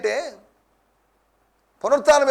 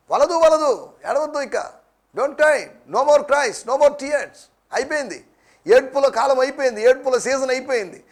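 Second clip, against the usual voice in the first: high, insistent preaching urge; spoken in short, forceful repeated appeals; emphatic exhortation; 4 semitones higher, 3 dB louder than usual. Acted. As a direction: urge them firmly to stop. Preacher urges congregation to stop crying.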